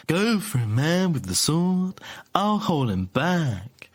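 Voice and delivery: high, slow heroic shout; character voice. Acted. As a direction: call out to your ally, drawn out. Fink voiced in retold game.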